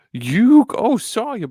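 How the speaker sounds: high, loud toddler-like attempt at Brooklyn; Joey's accent. Host imitating anime Joey apologizing, toddler-like accent. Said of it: "I almost sounded like a toddler there".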